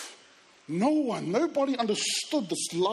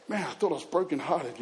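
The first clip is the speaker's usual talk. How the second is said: usual speaking voice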